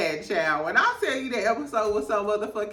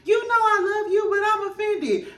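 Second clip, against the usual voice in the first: high, loud, hammed-up hurt; mock tearful Meredith; 11 semitones higher, 3 dB louder than usual. Meredith hugging Mary while offended, high and loud. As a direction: overplay the wounded hug.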